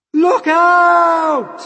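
very high, full-force scream of warning; James's warning shout. A narrator's reading. Boy warning centipede about to fall, urgent scream. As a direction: shout it out, stretching the last word. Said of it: "cried James".

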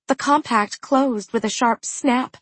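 plain reading voice

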